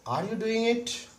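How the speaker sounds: "Are you doing it?" pitched high, climbing to the end; rising tone. Shown as a demonstration. Teacher contrasting a yes-no question for students, rising tone. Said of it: "Rising tone at the time".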